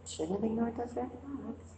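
regretful. Retold or imitated. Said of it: "how many times you go".

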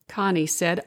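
plain reading voice

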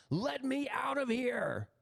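high, slow drawn-out cry for release; imitated shouting. Man imitating a trapped young woman, high restrained shout. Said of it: "This is what she was shouting".

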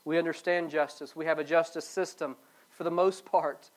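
ordinary delivery

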